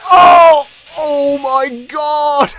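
loud high cry, then very slow; shout, then stretch out the disbelief; stunned outburst; shocked yell. Real, not acted. Crash after boost.

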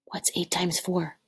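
whispered to herself. Real, not acted. Teacher whispering the sum to third graders. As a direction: hush it like thinking aloud.

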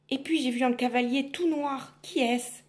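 lightly voiced shy girl; timid girl. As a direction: ask shyly. Girl questions witch.

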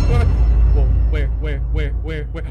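loud urgent where; loud alarmed search. Real, not acted. Player searching for the intruder, loud urgent calls. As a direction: call out hunting the danger. Looking for threat.

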